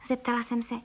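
ordinary delivery, nothing marked

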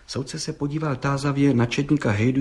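ordinary delivery, nothing marked

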